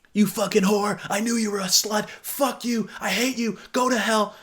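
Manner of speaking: high, hammed-up furious texter; mock angry rant. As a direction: rant like an enraged jerk. Reading the guy's abusive texts.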